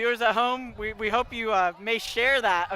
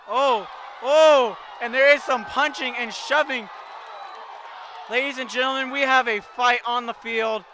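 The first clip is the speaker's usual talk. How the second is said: excited shouting; 2 semitones higher than usual. Real, not acted. Fight breaks out on the field.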